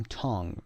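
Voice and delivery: "tongue" ending on ng, ue silent; no vowel after the ng in "tongue". Shown as a demonstration. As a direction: say "tongue" as tung. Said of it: "یو ای تلفظ نمیشه".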